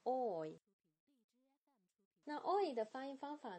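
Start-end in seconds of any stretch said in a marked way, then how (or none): 0.1-0.6 oi said as one glide from o to i; teacher models the diphthong oi, sliding o into i; oi demo. Shown as a demonstration. Teacher shows students the diphthong, a slow careful oi. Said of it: "慢慢地来学习双元音".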